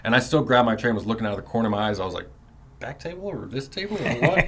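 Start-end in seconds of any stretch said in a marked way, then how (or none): 2.8-4.5 high, anxious asking which table; unsure high question. Retold or imitated. Young inmate asking the shot caller, high and unsure. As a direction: pitch it way up, half lost.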